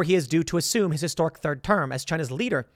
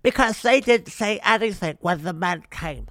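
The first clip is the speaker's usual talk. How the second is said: put-on politician impersonation; mocking caricature of a speaker's voice; Nancy Pelosi. Host finishing her quote to reporters, in a mocking impersonation. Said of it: "doing my impersonation of Nancy Pelosi".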